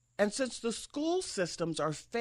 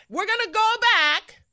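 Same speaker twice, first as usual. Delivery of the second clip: much higher, louder hammed-up alarm; mock alarmed cry; 11 semitones higher, 8 dB louder than usual. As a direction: push the parody to a wail. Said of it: "Hillary Clinton said".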